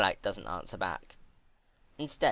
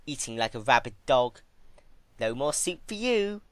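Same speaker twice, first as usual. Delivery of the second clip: higher, gleeful taunt; Twilight mocking; 5 semitones higher, 5 dB louder than usual. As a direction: cut her off with a smug verdict.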